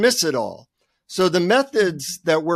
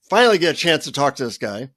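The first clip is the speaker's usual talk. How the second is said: usual speaking voice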